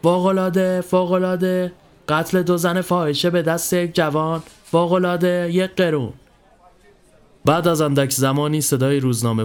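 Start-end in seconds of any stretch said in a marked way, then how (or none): 0.0-6.1 sing-out newsboy cry, pitched up; newspaper seller's cry. A narrator's reading. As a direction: hawk the paper to the crowd. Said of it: "صدای روزنامه فروشی در فضا پخش شد".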